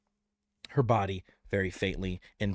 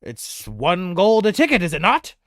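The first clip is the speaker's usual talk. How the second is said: puzzled question, a touch louder; confused; 6 semitones higher, 8 dB louder than usual. Acted. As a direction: sound thrown off. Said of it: "Very confused, he asks Nicholas".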